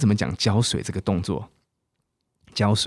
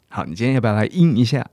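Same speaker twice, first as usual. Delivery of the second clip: ordinary talk, nothing marked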